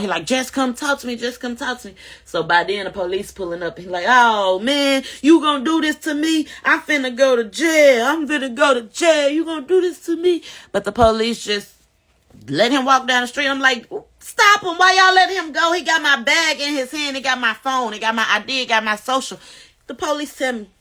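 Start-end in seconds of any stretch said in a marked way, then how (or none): 0.3-1.9 higher, coaxing come talk to me; imitating the ex, pleading; mock ex-husband. Ex pleading again. 4.1-10.4 high, loud complaining; imitating the ex, whiny and high; whining ex. Ex whining as police arrive. 14.2-19.4 high, loud plea fading down; reliving her shouted plea to the officers; desperate yell. Retold or imitated. Yelling at police to stop him.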